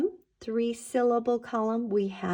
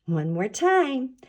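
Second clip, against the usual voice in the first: normal talking voice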